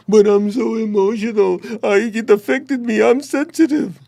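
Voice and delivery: high, whiny mock of an oversensitive person; whiny complainer. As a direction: squeak it out like someone wallowing in hurt feelings. Mocking how most people complain.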